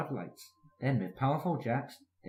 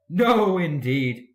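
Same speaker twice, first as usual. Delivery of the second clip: louder, higher huffy no; huffily; 5 semitones higher, 9 dB louder, much slower than usual. A narrator's reading. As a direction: snap it out, offended. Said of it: "said Gordon huffily".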